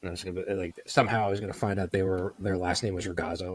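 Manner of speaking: ordinary talk, nothing marked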